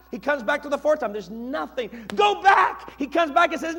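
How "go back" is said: loud, high shout; raised prophet's order; insistent command. Acted. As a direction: order it firmly at high pitch. Elijah ordering servant back.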